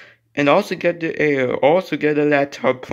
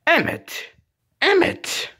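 very high pretend name call; insistent calling. Calling Emmett until he answers, insistent calling. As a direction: call it pitched far up.

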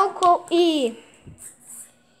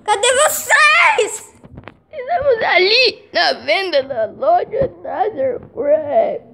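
Gutinho voice; 2 semitones higher, 3 dB louder, slower than usual. Pretend. Gutinho answering where the friends are, slow squeaky. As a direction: stretch each word out like a critter. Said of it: "mesma voz do Gutinho".

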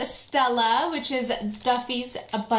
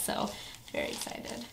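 whispering; 12 dB quieter than usual. Real, not acted. Favorite princess.

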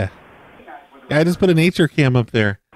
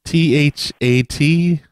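slow spelling; much slower than usual. Acted. Spelling a name slowly.

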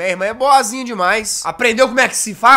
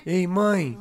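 usual speaking voice

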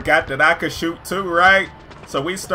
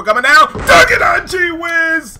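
hyped dunk call; 9 semitones higher, 6 dB louder than usual. Real, not acted. Player calls Kush dunking on the mascot, hyped yell. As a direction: explode as the dunk lands.

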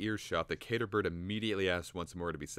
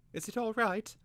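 put-on much higher, worried boy; anxious boy. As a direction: ask nervously. Twig voiced in story.

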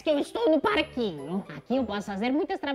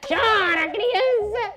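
gloating taunt; 8 semitones higher, 6 dB louder, much slower than usual. Pretend. Stealing a baby's ice cream.

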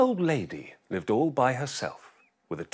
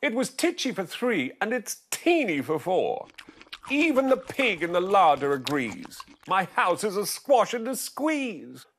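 put-on old lady crying out; 9 semitones higher, 3 dB louder than usual. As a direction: wail it like a frazzled old lady. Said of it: "The little old lady cried".

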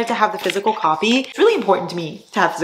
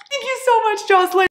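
much higher, gushing thank-you; high sing-song thanks. Real, not acted.

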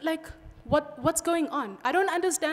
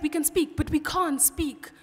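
ordinary delivery

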